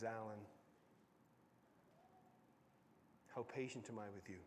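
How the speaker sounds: low, soft question from God; gentle voice of God. Acted. God gently questions Alan about his patience. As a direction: ask it softly, like a father.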